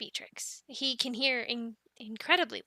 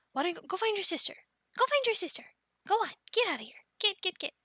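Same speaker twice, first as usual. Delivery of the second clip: higher-pitched, quick shooing; coaxing shooing; 6 semitones higher, much faster than usual. Acted. Keeper sending young dragon to find his sister, coaxing shooing. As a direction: chivvy a pet along in a raised pitch.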